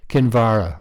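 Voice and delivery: Kinvara said back with VAR stressed; second-syllable stress. A narrator's reading. German repeats the father's correction, Kinvara stressed on its second syllable. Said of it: "repeated the German".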